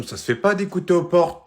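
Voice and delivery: normal talking voice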